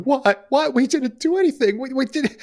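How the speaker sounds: high, half-hearted mock sobbing plea; pleading wife. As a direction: play the wife begging through mock tears. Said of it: "The wife begins to just sob uncontrollably".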